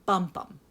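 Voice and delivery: "Bum" hit hard then "bum" light; trochee rhythm. Shown as a demonstration. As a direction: tap out "Bum bum" strong-weak. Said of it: "a stressed syllable followed by an unstressed syllable".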